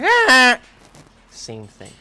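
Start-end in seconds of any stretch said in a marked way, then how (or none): 0.0-0.6 much higher, much louder piercing shriek; imitated movie scream; annoying shriek. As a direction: let out the most grating scream you can. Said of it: "And he's like".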